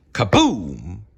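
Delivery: excited kaboom. Acted. Reader acting elephant falling over, excited crash. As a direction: land the crash with a bang.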